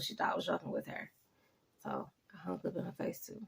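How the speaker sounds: hushed, breathy aside to the viewer; whispered aside. Real, not acted. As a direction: drop to a whisper for the confession. Whispered aside about hanging up.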